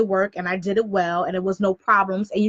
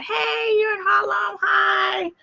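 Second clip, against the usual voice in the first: very high, drawn-out hello; high-pitched greeting; 11 semitones higher, much slower than usual. Real, not acted. Host greeting someone mid-broadcast, high calling voice.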